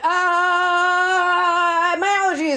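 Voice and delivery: high drawn-out wail; hammed-up wail of a feeble excuse; mock panicked excuse. Host imitating Laura Sue bolting from the room, mock panicked wail. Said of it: "very fucking nervous about this".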